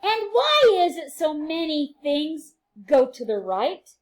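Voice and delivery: bright, sing-song read-aloud for little ones; reading to children. A narrator's reading. Teacher reading a rhyming book to her class, sing-song and lively.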